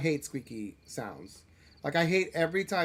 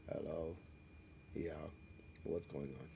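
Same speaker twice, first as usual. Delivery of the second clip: much lower, softer, sleepy; groggy morning voice; 10 semitones lower, 15 dB quieter than usual. Retold or imitated. Streamer imitating his groggy morning voice on the phone to family. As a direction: sound just woken, low and quiet. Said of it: "they don't recognize my voice".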